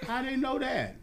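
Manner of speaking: very high innocent denial; feigned innocence. Retold or imitated. Bird denying it to handler, high mock-innocent.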